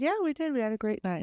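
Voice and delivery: high, bright reassurance; reassuring. Retold or imitated. Reassuring the sobbing man about their night, high and soothing. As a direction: cheer him up, voice lifted high.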